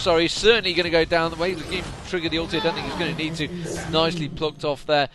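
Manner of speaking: loud, fast, high commentary; excited play-by-play. Real, not acted. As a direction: call the fight as it happens. Fight breaks out, live call.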